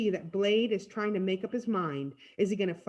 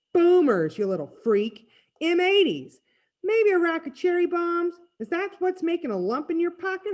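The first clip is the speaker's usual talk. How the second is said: loud, high-pitched jeering; pushy, mocking interrogation; bully's taunt; 9 semitones higher, 5 dB louder than usual. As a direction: needle him like a street bully. Bully taunts Freak in story.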